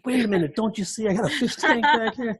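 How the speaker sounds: high, loud, laughing protest at angry drivers; exasperated mock yell. To honking drivers, behind the wheel with a fish tank: laughing exasperated yell. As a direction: yell it, half laughing in disbelief.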